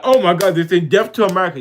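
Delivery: high, loud, hurried mock panic; mocking quote. Mocking people's panic to her viewers, hammed-up alarm. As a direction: parody their alarmed overreaction.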